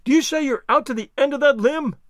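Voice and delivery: high, excited question; mild scream. A narrator's reading. Treasure hunter checks servant's position, high excited cry. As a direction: press him for confirmation, pitched high. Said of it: "here fairly screamed Legrand".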